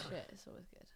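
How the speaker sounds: whispered teasing aside. Real, not acted. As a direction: drop to a whisper.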